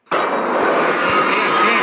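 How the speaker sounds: long, high, loud where; drawn-out call. Acted. Gamer calling out into an empty corridor, long and loud. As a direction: stretch it out like calling into the dark.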